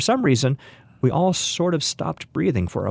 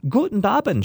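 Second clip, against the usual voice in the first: high, joyous German greeting; cried out in greeting; 8 semitones higher than usual. A narrator's reading. Old woman joyfully greeting her guests at the door, high. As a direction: call out Guten Abend happily, pitched up. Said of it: "she cried".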